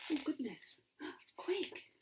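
high, gasping near-whisper; hushed and hurried, with a gasp; anxious aside. Real, not acted. Reader's worried aside to child.

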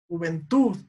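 juvenTUD, weight on the final TUD; stress on last syllable. Shown as a demonstration. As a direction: land firmly on TUD in "juventud". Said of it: "es tud". (juventud marcando la sílaba tónica TUD)

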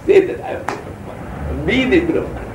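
words breaking up into soft laughter; laughing. Real, not acted. Teacher telling listeners to be the Brahman, laughing as he speaks. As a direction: chuckle through the words, losing voice.

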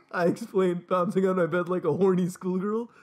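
ordinary talk, nothing marked

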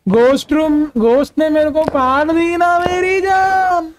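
meme voice. Pretend. Streamer redoing a viewer meme for chat, hammed-up slow and high. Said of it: "Once more मेरी जान वाला meme".

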